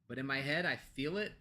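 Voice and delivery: ordinary talk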